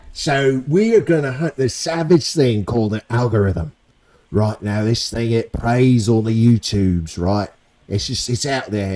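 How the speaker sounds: hammed-up high British accent, pleading for likes; cheeky British accent begging viewers to subscribe; put-on British accent. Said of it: "Let me know if this accent is shite".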